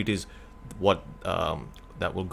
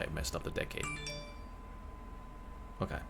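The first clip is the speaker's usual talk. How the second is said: ordinary talk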